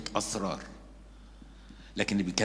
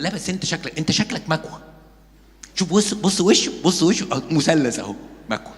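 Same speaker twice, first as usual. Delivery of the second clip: mocking friend; 5 semitones higher, 4 dB louder than usual. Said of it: "واحد يقول للتاني".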